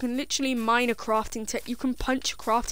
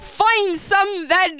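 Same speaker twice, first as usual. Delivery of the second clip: mocking retort; 8 semitones higher, 6 dB louder, much slower than usual.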